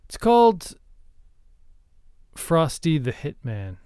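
ordinary talk

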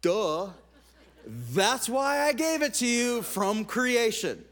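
loud, drawn-out mock exasperation from on high; hammed-up divine "duh"; exasperated God. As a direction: play God stating the obvious, slowly and loudly. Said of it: "God's up there going".

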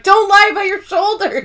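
very high-pitched mock indignation; mock outraged scolding. Dwight scolds about shoulder pads, mock outraged scolding. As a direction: imitate an indignant outburst, voice shooting up. Retelling Dwight's outburst, amused.

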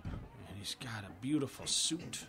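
hushed aside; whisper. Acted. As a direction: whisper it behind a hand.

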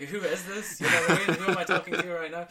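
high, loud mock bafflement through laughter; confused people, mocked. Coach mimics people thrown by his switching, laughing. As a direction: laugh while aping puzzled listeners. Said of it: "People are like".

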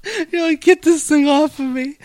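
high squeaky panicked voice; mock panicked squeal. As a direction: squeal it like you're grossed out. Imitating Jimmy's panicked reaction.

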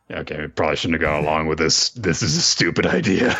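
low, quick unimpressed grumble. Retold or imitated. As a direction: voice a character's dawning regret, understated. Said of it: "more and more unimpressed".

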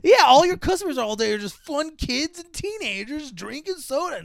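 high, gushing sales pitch; hammed-up recruitment ad voice; mock advert cheer. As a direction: sell it with fake upbeat enthusiasm.

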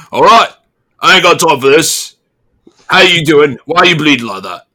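a busy guard with no time for this; guard questioning the bleeding stranger, loud and curt; impatient guard. Acted. GM voicing the guard.

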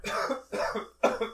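sudden choking cough on coffee; hacking cough after a swallow went wrong; coughing fit. Real, not acted. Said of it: "I just choked on my coffee".

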